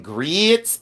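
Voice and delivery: GRITS stretched as "greets", rising; mock Southern "greeets"; imitated Southern drawl. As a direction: draw out the vowel in GRITS, mimicking Southerners. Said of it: "I love their accent".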